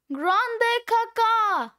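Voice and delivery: high, drawn-out gleeful cry; big exultant exclamation, stretched out; triumphant shout. Acted. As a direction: belt out the punchline slowly. Triumphant punchline, shouted slowly.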